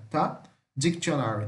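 ordinary talk, nothing marked

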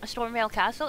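normal talking voice

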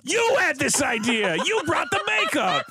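high mock accusation, hammering each phrase; hammed-up outraged blame; staged fight. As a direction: pretend to yell accusations at your partner. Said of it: "we start having a rolling around fist fight".

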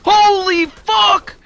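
high, loud, drawn-out squeals; shrieking. Real, not acted. Gamer swarmed by enemies, shrieking in shock. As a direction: let it rip in stunned panic. Overwhelmed mid-fight.

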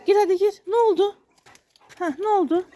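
high-pitched pet talk; talking to a dog. Real, not acted. Owner puts his dog inside, high pet-talk.

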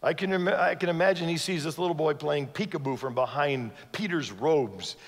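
usual speaking voice

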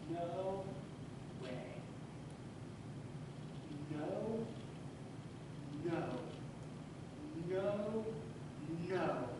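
broken, hushed realization; quiet inner vow. Retold or imitated. Father thinking to himself in the dark, hushed.

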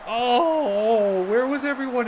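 sudden high-pitched frustration; voice jumps up. Real, not acted. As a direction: let the voice fly up an octave in disbelief. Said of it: "why did my voice just go up to that octave".

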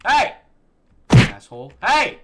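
loud furious shout at the screen; real rage outburst; angry yell. Real, not acted. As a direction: yell at the game. Yelling at game.

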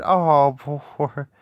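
normal talking voice

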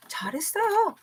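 soft breathy 잘했어요; hushed praise for kids; whispered praise. Acted. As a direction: whisper the good job. Praising class softly.

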